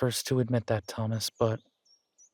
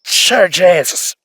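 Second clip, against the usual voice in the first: much louder, higher outraged outburst; indignant Irish-accented shout; startled yell; 6 semitones higher, 11 dB louder, slower than usual. Acted. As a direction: yell it in shocked protest, drawn out. Startled cry after sudden blow.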